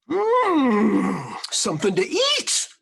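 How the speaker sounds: high, loud longing moan over food; hungry bear. A narrator's reading. Hungry bear following a delicious smell, moaning.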